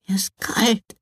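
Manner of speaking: a small, high, pitiful whisper; little sister, freezing in the snow, whispers miserably; shivering whisper. A narrator's reading. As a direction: whisper it while shivering with cold. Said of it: "bibberte sie kläglich".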